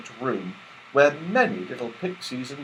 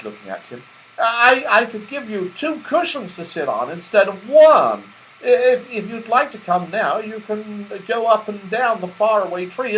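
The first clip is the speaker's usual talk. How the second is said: high, hesitant Moonface; stammering Moonface; 9 semitones higher, 6 dB louder than usual. Acted. Moonface inviting Santa to the slippery slip, hesitant. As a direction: reassure him, tripping over words.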